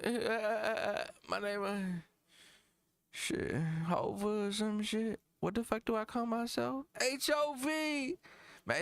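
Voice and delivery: high, tired, muddled rapper parody. Acted. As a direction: stumble through your own stage name, worn out. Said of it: "that's a fucking [name] performance".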